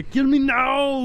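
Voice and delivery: high, loud, drawn-out action-star cry; mock Austrian movie shout; Arnold Schwarzenegger. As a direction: yell it slowly like the famous actor. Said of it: "Predator has Arnold's best line".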